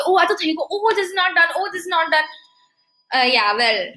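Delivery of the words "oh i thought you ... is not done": mock-flustered, pitched up; higher, lightly worried re-enactment; nervous retold fretting. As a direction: replay a little panic in a higher voice. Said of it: "we got a little nervous".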